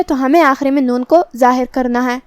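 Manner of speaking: ordinary talk, nothing marked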